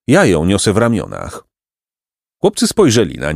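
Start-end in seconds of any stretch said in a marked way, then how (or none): none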